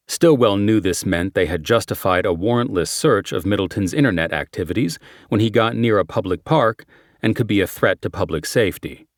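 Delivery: usual speaking voice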